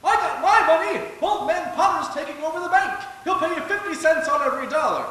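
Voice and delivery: loud, frantic warning to the crowd; shouting over a bank crowd. Acted. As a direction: yell it to start a panic. Panicked depositor shouting at crowd.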